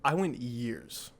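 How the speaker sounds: usual speaking voice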